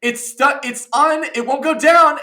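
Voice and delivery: high, loud frantic outburst; exasperated yelling. Retold or imitated. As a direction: yell it in mounting frustration. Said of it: "I'm just yelling in my room".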